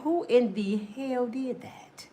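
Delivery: slow, hushed whisper. Retold or imitated. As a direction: whisper it in shock. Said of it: "You like".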